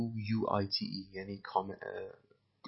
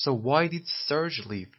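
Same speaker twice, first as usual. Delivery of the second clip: slow repeat; 5 semitones higher, 4 dB louder, much slower than usual. Shown as a demonstration. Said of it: "teacher repeats line slowly".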